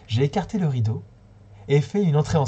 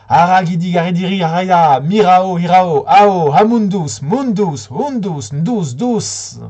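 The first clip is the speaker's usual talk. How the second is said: loud, high chanted spell; witch incantation; 4 semitones higher, 9 dB louder, much slower than usual. A narrator's reading. Dark witch Zisis chants a spell over Amundus. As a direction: cast the spell like a menacing witch. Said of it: "toute noire et menaçante, a lancé".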